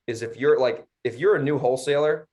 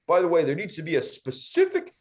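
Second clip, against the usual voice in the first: higher mock voice of a fussy buyer; pompous, nitpicky buyer impression; 6 semitones higher than usual. Mocking the buyer's demand.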